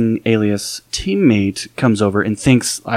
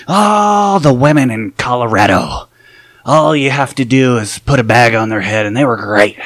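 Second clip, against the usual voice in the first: sleazy dealer; 3 dB louder, slower than usual. Retold or imitated. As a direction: sell a cheap joke to the table. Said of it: "and this dealer's like".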